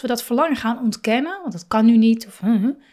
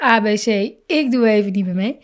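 quick, offhand "I'm out" toss-off; breezy mock resignation; fed-up quip; 3 dB louder, faster than usual. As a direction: rattle it off like you're done with everything. Thinking of dropping out.

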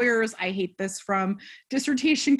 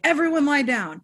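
raised, louder know-it-all voice leading a ritual; 6 semitones higher, 5 dB louder than usual. Retold or imitated. Mocking the guy ordering everyone to lie down. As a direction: order the group down like a self-appointed leader. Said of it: "He's like".

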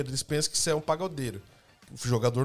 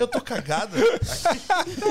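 high, loud, slow through laughter; drawn-out high quote breaking into laughter; laughing retold quote; 7 semitones higher, 5 dB louder, much slower than usual. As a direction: crack up while repeating the punchline. Repeats punchline while everyone laughs.

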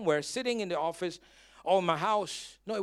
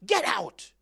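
angry command; 7 semitones higher, 5 dB louder than usual. Retold or imitated. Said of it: "this anger in me".

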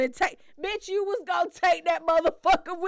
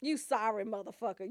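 ordinary talk

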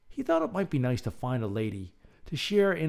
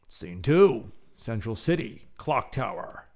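usual speaking voice

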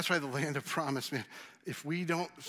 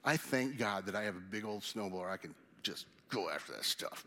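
normal talking voice

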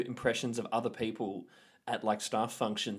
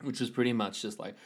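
ordinary delivery

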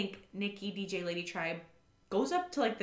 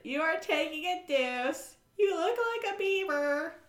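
higher, teasing put-on voice; lightly voiced taunt; mocking Ashley; 8 semitones higher than usual. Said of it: "Ashley's like".

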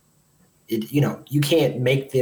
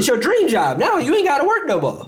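high sing-song mock; lightly mock the promise; 11 semitones higher than usual. Mocking the dream-job promise.

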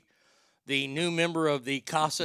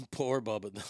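ordinary delivery

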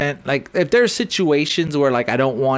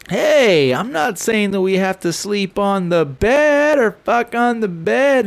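a hint of higher drunk pleading; imitating Corey; 6 semitones higher than usual. Corey bargaining with his wife, mild mock plea. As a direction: plead it like a tipsy friend. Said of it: "And then he goes".

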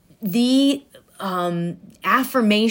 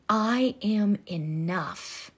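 tender affirmation; 6 dB quieter, much slower than usual. Shown as a demonstration. Coach demonstrating a tender mirror affirmation. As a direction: say it gently to your own reflection. Slow soft affirmation demo.

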